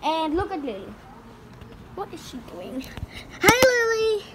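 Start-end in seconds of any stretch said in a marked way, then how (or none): 3.4-4.2 high greeting. Real, not acted. Child greeting cat for camera.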